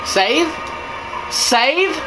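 high, hopeful questioning. Real, not acted. Looking for save point.

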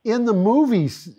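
movies said high and loud; stress on movies. Real, not acted. Host corrects Tom with a loud, high stress on movies. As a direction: punch the word movies up high. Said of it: "they talk like that".

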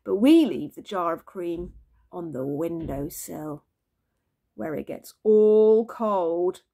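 ordinary delivery

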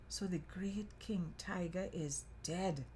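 slow, hushed, whispery delivery; near-whisper, drawn out; hushed dismay. A narrator's reading. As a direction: say it softly and slowly, shaking your head. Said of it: "he shook his head and said".

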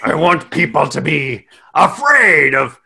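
big mock pirate voice, slowing at the end; sarcastic pirate. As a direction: play a hammed-up sarcastic pirate. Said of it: "sarcastic pirate".

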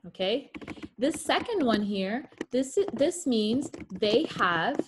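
ordinary talk, nothing marked

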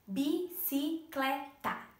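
"bicicleta" slowly split as bi-ci-cle-ta; four separate, deliberate beats on "bicicleta"; syllable by syllable. Shown as a demonstration. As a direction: sound out "bicicleta" one syllable at a time. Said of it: "Tem quatro sílabas".

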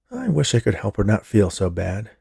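ordinary delivery

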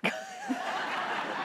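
high squealing laugh; laughing hard at the joke; high burst of laughter. Real, not acted. Laughing at the reply.

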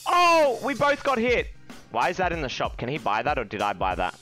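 ordinary talk

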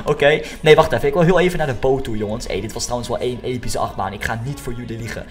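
normal talking voice

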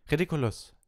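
loud, high called-out spell. A narrator's reading. Neville charges at Snape, calling out. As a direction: fire the spell out at full voice. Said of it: "rief er".

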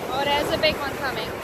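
usual speaking voice

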